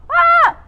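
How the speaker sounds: sharp high yelp of surprise; surprised cry. Real, not acted. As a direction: yelp as something goes wrong. Sudden strain mid-workout.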